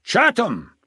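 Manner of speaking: loud, high call across the room; a sudden royal summons shouted; shouted name. A narrator's reading. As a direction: bark the name out to summon him. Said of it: "he spun round suddenly and shouted".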